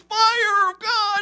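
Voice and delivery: high, loud ecstatic cry; yelled call. Retold or imitated. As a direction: shout it out like a fired-up worshipper. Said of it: "And another guy goes".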